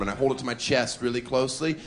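ordinary talk

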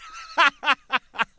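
high, loud burst of laughter in a different voice; hearty laugh. Real, not acted. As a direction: crack up at the memory. Laughing at the memory.

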